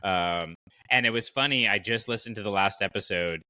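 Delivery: normal talking voice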